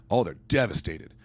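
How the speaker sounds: ordinary talk, nothing marked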